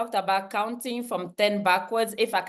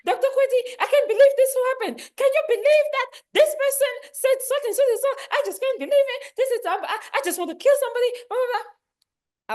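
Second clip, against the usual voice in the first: mock upset yelling; faster than usual. Upset colleague ranting at Doctor Kweti, high and fast. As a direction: rant like a worked-up coworker. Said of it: "they're yelling".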